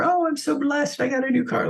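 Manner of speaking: high-pitched mock humblebrag; mock gushing. Imitating a social media braggart showing off a new car, mock gushing. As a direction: gush it like a show-off, pitched well up.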